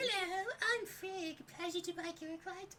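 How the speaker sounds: put-on high, fast British lady accent; a prim British woman greeting. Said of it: "why she went British again".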